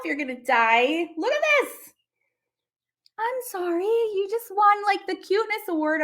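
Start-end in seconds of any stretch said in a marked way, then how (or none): 1.2-6.0 high delighted gush; excited high gush. Real, not acted. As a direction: squeal over the photo. Gushing over cute photo.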